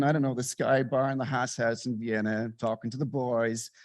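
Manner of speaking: normal talking voice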